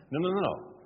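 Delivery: ordinary talk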